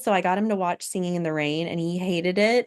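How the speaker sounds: usual speaking voice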